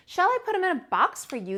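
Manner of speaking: high, polite shop assistant; sales lady voice. Acted. Sales lady asking the girl, polite. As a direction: offer it brightly. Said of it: "the sales lady asked".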